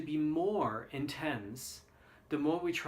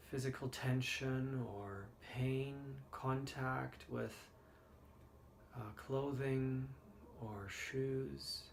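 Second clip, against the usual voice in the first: guided meditation; 6 dB quieter than usual. Acted. Leads the body scan meditation.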